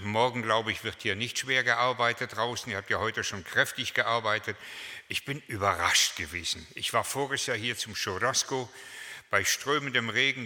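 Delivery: ordinary talk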